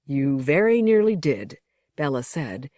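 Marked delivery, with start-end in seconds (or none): none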